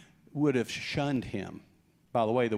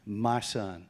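tender father; 6 semitones lower, 4 dB quieter than usual. Acted. Father tenderly claims son.

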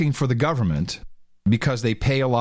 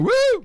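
high "woo" whoop; shill's cheer; 3 dB louder than usual. Retold or imitated. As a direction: imitate a lone planted cheerer. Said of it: "it goes again".

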